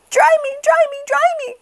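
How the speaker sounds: put-on very high, loud baby whine; very high whining baby chick; soggy baby chick. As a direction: beg to be dried as a tiny chick. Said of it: "cried the soggy baby chick".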